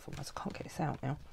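fast whispered mutter to herself; whispered aside. Real, not acted. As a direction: drop to a quick breathy whisper. Muttering to herself while fiddling.

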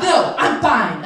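loud, slow mock assurance; emphatic insistence. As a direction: insist it, overly forceful. Insists to paramedics she is fine.

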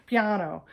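Piano with a high open ah; Italian ah. Shown as a demonstration. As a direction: stress the bright ah in Piano. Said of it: "It has a high ah".

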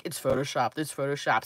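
low mock-sceptical mumble; mocking doubters. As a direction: mimic the doubters dismissively. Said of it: "everybody was like".

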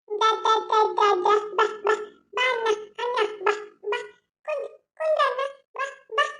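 put-on high, soft toddler babble calling her brother; small child voice. Little girl softly calling her brother to sit and play. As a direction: coax big brother over like a little girl.

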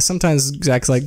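normal talking voice

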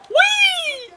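high whoop. Real, not acted. High-flying move.

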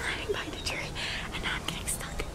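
hushed whisper while hiding; breathy hiding whisper. Real, not acted. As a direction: whisper so he won't hear.

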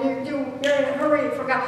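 ordinary delivery

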